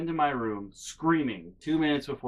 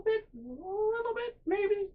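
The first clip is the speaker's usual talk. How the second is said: climbing into a very high, small voice; squeaky doubt; 3 dB quieter, slower than usual. Real, not acted. Comedian doubting own claim about family, high-pitched hedging. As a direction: slide up higher and higher, hedging.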